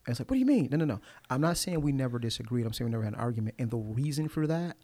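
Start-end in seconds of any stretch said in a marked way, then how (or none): 0.3-0.7 squeaky high incredulous question; mock disbelief. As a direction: play the stunned listener, way up high. Imitating a listener's disbelief.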